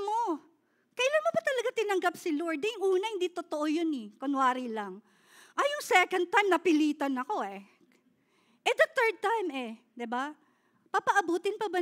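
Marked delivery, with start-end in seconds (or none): none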